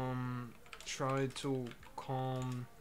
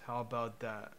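normal talking voice

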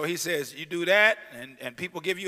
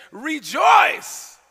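loud, high command; voice of Jesus; 9 semitones higher, 12 dB louder than usual. Acted. Jesus commanding the persecuted to rejoice, loudly. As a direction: call out the command with joy. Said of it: "Jesus says".

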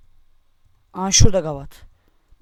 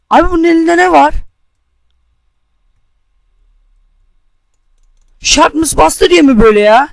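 loud disbelief; 5 semitones higher, 6 dB louder than usual. Real, not acted. Shocked at opponent's item.

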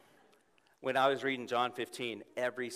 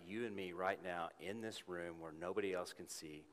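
softer, lower, gentle voice of God; gently; 3 semitones lower, 5 dB quieter than usual. Retold or imitated. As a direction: speak low and tender, like God in a quiet room. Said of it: "said to me gently".

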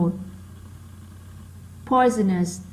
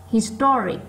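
STO in "historic" punched; stressed second syllable; 2 semitones higher than usual. Shown as a demonstration. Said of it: "nó to hơn những âm còn lại". (nhấn mạnh âm hai của "historic")

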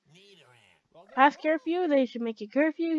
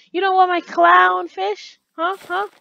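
high, very loud incredulous question; outraged, raised-pitch challenge; escalated mock indignation; 7 semitones higher, 11 dB louder than usual. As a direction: demand it at a near shout.

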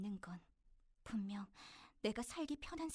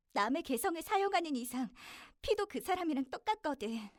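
loud, raised-pitch declaration; defiant outburst; 4 semitones higher, 8 dB louder than usual. Acted. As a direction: burst out with it, loud and insistent. Acted defiant outburst in confession.

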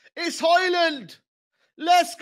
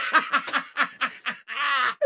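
quieter trailing laughter; soft laugh; 4 semitones lower, 8 dB quieter than usual. Real, not acted. Fan laughing softly. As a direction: let the laugh fade.

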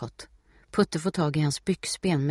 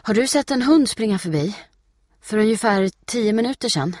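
high, loud, urgent asking; panting question; 7 semitones higher, 7 dB louder than usual. A narrator's reading. Steffi asking Britta about Putte, panting. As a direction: ask urgently as if winded. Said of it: "flåsar Steffi".

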